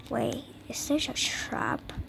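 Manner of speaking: soft unvoiced whisper; tense whispered question. Real, not acted. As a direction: whisper it, afraid of being heard. Sneaking through a scary level.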